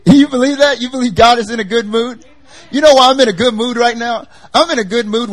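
high, loud joyful challenge; excited exclaim. Real, not acted. Preacher asking the congregation, high and excited. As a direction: burst out with delight.

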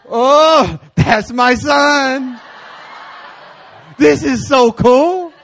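loud, slow, laughing cry of pride; proud dad laughing and shouting; proud papa shout. Retold or imitated. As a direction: crow with delight about your son. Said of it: "I'm sitting back as a papa".

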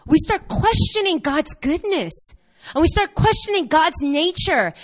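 ordinary talk

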